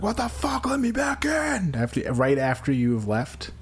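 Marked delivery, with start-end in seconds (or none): 0.0-1.7 much higher, slow outraged yelling; mock indignant yell. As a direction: pound on the door, indignant.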